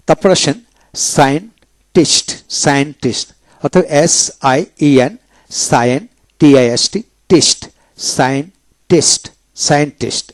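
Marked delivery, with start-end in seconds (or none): 1.2-2.3 slow scientist, split as sayen, pause, tist; syllable by syllable. Shown as a demonstration. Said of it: "এইভাবে হবে". 8.2-9.2 slow scientist with a gap before tist; syllable by syllable. Shown as a demonstration. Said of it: "এইভাবে হবে".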